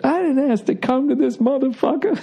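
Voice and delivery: repeated with relish, high; Richard Pryor quote. Retold or imitated. Quoting his favorite Pryor line, relishing it. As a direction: savor the line again. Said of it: "one of my favorite lines he ever had".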